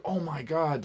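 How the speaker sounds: high, hushed startled gasp of words; retold scare. Comic retells being startled by a gunman, mock scared. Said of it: "like, I was scared".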